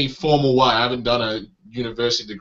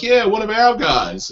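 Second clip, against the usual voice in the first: higher-pitched cheering on a local; imitated local pride; 8 semitones higher than usual. London crowd welcoming one of their own, mock pride. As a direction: play a proud home crowd. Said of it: "They're like".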